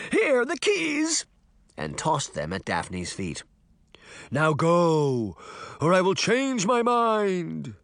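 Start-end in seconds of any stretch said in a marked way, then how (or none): 0.1-1.3 very high, drawn-out panicked cry; frightened shout. A narrator's reading. Deputy surrendering keys to the Horseman, shouting in fright. 4.3-7.7 drawn-out, raised phantom voice; ghostly command. A narrator's reading. Sabrina as the Horseman dismissing Crane, ghostly command.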